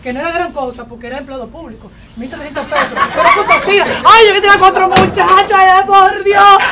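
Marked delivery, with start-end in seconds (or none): 3.1-6.6 loud, high melodramatic wailing; hysterical wailing wife. Acted. Wife to doctor, loud high hammed-up wailing. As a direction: howl it like a soap opera widow.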